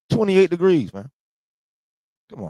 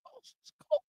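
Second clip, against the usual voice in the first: much higher, thin whiny complaint; mock whining; 15 dB quieter than usual. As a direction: whimper it like a freezing kid. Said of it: "I'm like".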